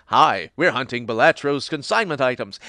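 a hint of polite adventurers; lightly voiced adventurers. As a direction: play the earnest heroes. Voicing the adventurers.

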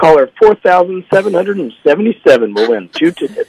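loud, playful radio announcer. Pretend. Guest calling the winning caller number, in radio voice. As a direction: punch it out in a mock radio voice. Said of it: "You gotta do it in your best radio voice".

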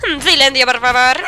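loud shush and firm command; scolding the dog. Real, not acted. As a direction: shush the noisy dog loudly. Scolds barking dog Max.

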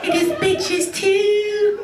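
very high puppet voice singing; Elmo. Retold or imitated. Elmo drunk in the car, singing high and squeaky. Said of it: "let's do Elmo".

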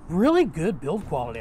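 ordinary talk, nothing marked

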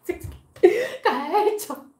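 exaggerated crying lament, laughing through it; mock sobbing. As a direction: blubber it out like a distraught hairdresser. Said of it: "está llorando, literalmente llorando".

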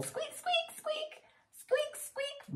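tiny very high-pitched squeaks; mouse squeak. A narrator's reading. Storyteller voices the mouse for preschoolers, high squeaks. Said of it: "the mouse went squeak".

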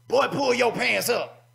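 put-on stern older churchgoer barking at a boy; mock a scolding elder. Preacher voices scolding elders.

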